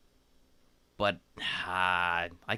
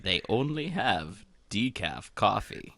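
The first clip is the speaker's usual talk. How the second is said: ominous; slower than usual. Pretend. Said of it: "maybe you should say more ominous".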